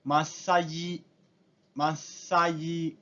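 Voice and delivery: "masaji" said very slowly, syllable by syllable, with a held double s; "masaji" drawn out slowly in katakana style; katakana pronunciation. Shown as a demonstration. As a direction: spell out "masaji" slowly the Japanese way, holding the doubled consonant. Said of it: "massage se convierte en".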